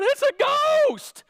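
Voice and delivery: high, loud mock panic building to a shriek on ghost; frightened cry. Preacher hamming up the disciples' panic, mock terror. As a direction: act out the disciples' terrified yell. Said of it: "In their fear, they cried out".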